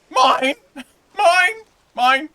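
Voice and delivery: very high, loud gull cries; squawking seagull. Acted. Mimics seagulls after coastal noises.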